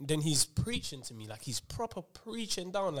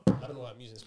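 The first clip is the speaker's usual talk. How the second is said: ordinary talk, nothing marked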